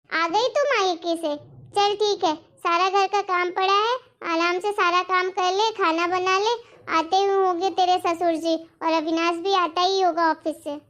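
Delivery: a harsh mother-in-law handing out chores; bossy mother-in-law. Acted. Mother-in-law orders daughter-in-law.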